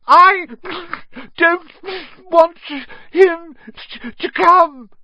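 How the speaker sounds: very high, loud fake bawling; yelled between pretend sobs. A narrator's reading. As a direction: wail it brokenly like a spoiled child. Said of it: "Dudley yelled between huge pretend sobs".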